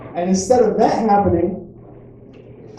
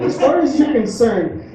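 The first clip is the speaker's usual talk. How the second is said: rising, higher-pitched exasperated ranting; mock stern dad; 4 semitones higher than usual. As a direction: overdo a dad laying down the law to his child. Acted overblown refusal to child.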